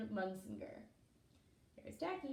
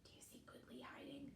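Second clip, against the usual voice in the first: hushed quick whisper; 3 semitones higher, 18 dB quieter than usual. Real, not acted. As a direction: lean in and whisper the secret. Secret aside to children.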